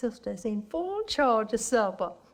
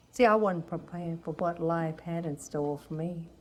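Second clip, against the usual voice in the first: normal talking voice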